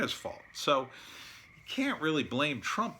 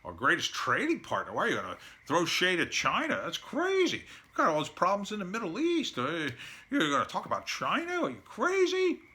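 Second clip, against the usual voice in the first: much higher, squeaky mock outrage; high mocking voice of hysterical pundits; mocking critics; 5 semitones higher than usual. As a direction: lampoon the panicked critics in a shrill parody voice. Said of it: "everybody's like".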